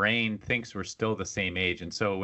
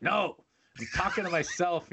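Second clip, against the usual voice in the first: higher, retold shout back upstairs; mock defensive reply; 8 semitones higher than usual. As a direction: imitate an irritated answer to your partner. Acts irritated reply to spouse.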